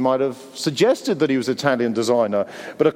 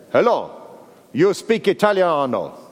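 put-on terrible Italian accent; 3 semitones higher than usual. Stranger calling from his car window, bad Italian accent. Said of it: "That is a terrible Italian accent".

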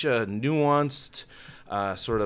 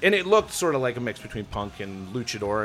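normal talking voice

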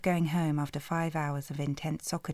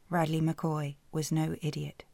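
ordinary delivery

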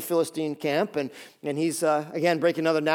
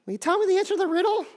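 much higher falsetto, sweet wheedling plea; pleading fiancée; faster than usual. Retold or imitated. Fiancée coaxing Samson for the riddle, high wheedling falsetto. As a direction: coo it like a manipulative sweetheart. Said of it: "she pulls a line that most women pull".

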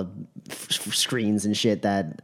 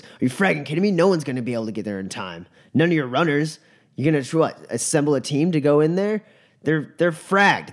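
louder, higher incredulous rant; exasperated anger; 7 semitones higher, 4 dB louder than usual. Acted. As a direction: tear into her idea, fed up. Godsmack angrily argues.

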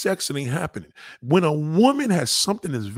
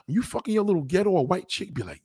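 ordinary delivery

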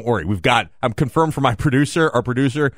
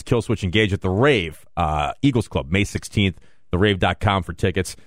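low, softer radio prize read; sponsor read; 4 semitones lower, 6 dB quieter than usual. Acted. Host reads prize list to listeners, low sponsor read. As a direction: run down the sponsors in a lowered voice.

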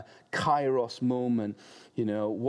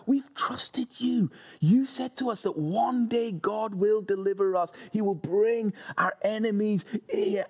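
pressing men; 10 semitones higher than usual. Acted. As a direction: shout them into seizing the moment. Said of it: "the voice of his men saying to him".

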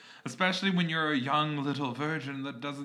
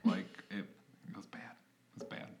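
near whisper, trailing off; hushed confession; 5 semitones lower, 12 dB quieter, faster than usual. Real, not acted. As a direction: say it softly as if ashamed.

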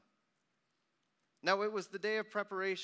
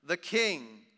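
loud, high, slow proclamation; proclaimed inscription; 7 semitones higher, 12 dB louder, slower than usual. A narrator's reading. Inscription put on the cross, loud proclamation. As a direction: ring it out like a herald.

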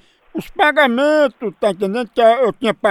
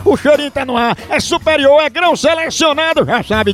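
brisk radio ad pitch for the coffee; fast, pushy on-air commercial voice; ad read; faster than usual. Acted. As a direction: sell it like a live radio spot, quick and upbeat. Radio host reads coffee ad.

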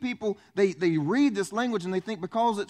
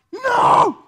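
huge high shouted no; 13 dB louder than usual. Retold or imitated. Pastor shouting at a congregant's plan, huge and high. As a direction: yell it in mock horror. Pastor reacts to bad decision.

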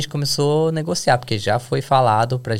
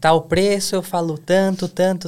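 higher-pitched mock indignation; retold shouting vendor; 6 semitones higher than usual. Vendor protesting to a haggling customer, mock indignant shouting. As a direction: imitate a vendor complaining in a raised pitch. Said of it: "Começou a gritar".

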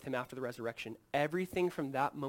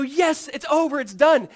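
relieved cheer; 11 semitones higher, 13 dB louder, slower than usual. Pretend. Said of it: "And you go".

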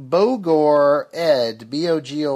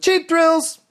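jingle-style tagline; 5 dB louder than usual. Acted. Shout-out tagline after a listener comment.

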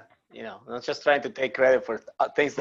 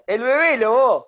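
Argentinian Spanish; 8 semitones higher, 6 dB louder than usual. Shown as a demonstration. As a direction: announce El Bebelo like an Argentinian stadium call. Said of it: "I am a member of the Argentinians".

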